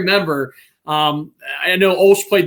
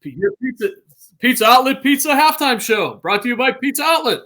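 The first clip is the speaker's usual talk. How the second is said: higher announcer sponsor plug; mock broadcaster ad read; radio ad read; 8 semitones higher than usual. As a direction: sell it like a halftime radio spot. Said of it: "my old Bill Hillgrove impression".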